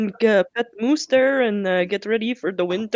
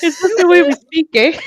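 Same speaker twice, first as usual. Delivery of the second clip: laughing; 12 semitones higher, 4 dB louder than usual. Pretend. Laughing Canadian bit.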